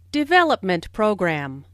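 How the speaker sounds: Development stressed, program lighter; weight on Development, not program; compound noun stress. Shown as a demonstration. Teacher showing compound stress on Development program. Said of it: "the main stress falls on development".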